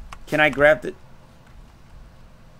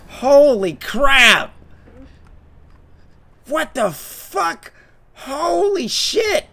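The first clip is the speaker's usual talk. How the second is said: slow, drawn-out high exclamations; stunned relief; 10 semitones higher, 4 dB louder, much slower than usual. Real, not acted. Shaken player recovers after the chase, slow and high. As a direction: stretch out the disbelief.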